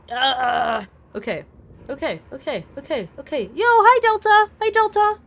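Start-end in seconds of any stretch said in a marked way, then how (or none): 3.5-5.2 higher, louder hello to a chatter; excited greeting to a chatter who just arrived. Real, not acted. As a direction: call out a happy hello.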